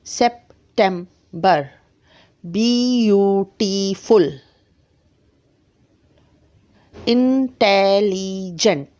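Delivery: "सेप्टेम्बर" "ब्यूटीफुल" "इंटेलिजेंट" said slowly with each syllable separated; slow classroom syllable drill; syllable demo. Shown as a demonstration. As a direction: stretch each word into its syllables. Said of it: "आप समझ रहे हैं ना syllable क्या होता है?".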